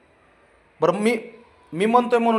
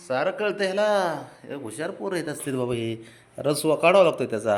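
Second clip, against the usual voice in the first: usual speaking voice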